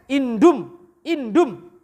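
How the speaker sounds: indum said a bit slowly with the m closed; teacher mimicking a wrong reading; wrong reading demo. Shown as a demonstration. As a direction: show the mistaken reading indum. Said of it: "Kalau dia mimnya sukun nanti indum".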